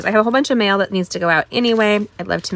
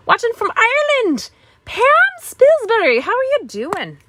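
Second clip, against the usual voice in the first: put-on a lightly butchered Irish accent, pitched higher; bad Irish accent; 14 semitones higher, 3 dB louder than usual. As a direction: greet her in a hammed-up Irish lilt. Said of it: "I'm so butchering your accent".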